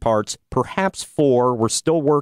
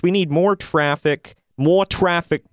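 normal talking voice

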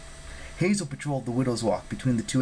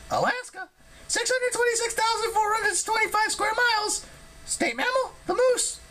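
very high, squeaky figure voice; excitable harpy Ella. Acted. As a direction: blurt it out in a tiny, piercing high voice.

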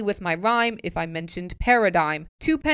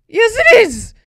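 shouting back; 13 semitones higher, 12 dB louder than usual. Real, not acted. Retorting to the poem.

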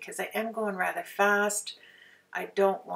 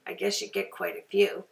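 ordinary talk

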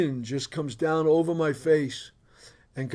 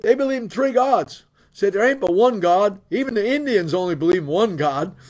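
louder, raised retold protest; indignant wife; 4 semitones higher, 5 dB louder than usual. Wife explaining her objection to husband, indignant and loud. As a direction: insist on it as an exasperated wife. Wife's angry retold argument.

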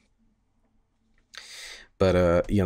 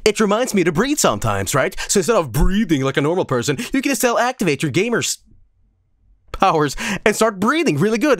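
asshole salesman voice; 6 semitones higher than usual. Pretend. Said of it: "activate my asshole voice".

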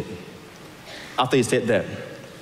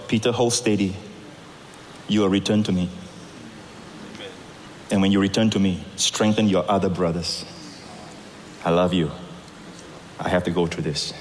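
tender reassurance; 6 semitones lower, 4 dB quieter than usual. Acted. Jesus' tender unspoken words.

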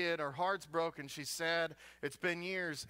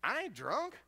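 raised, high protest; indignant Hannah; 6 semitones higher, slower than usual. Retold or imitated. Hannah snapping back at the priest, high and indignant. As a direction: insist she's sober, pitched up. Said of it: "she looks at him and says".

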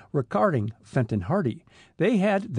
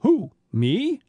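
high, louder startled demand; alarmed; 8 semitones higher, 5 dB louder than usual. A narrator's reading. Detective learning he is being sent alone, alarmed. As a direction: jump up in pitch and volume, alarmed. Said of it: "demanded Smuff in alarm".

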